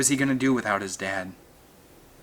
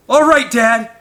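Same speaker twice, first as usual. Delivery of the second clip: high, loud cheering yell; encouraging yell; 12 semitones higher, 11 dB louder than usual. A narrator's reading. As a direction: cheer him on from afar. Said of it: "Eric yelled".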